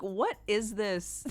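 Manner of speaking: amused disbelief. Retold or imitated. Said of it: "I was like".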